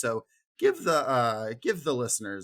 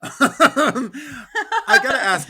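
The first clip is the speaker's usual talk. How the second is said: laughing; 12 semitones higher, 9 dB louder, much slower than usual. Real, not acted. Laughing before asking guests question.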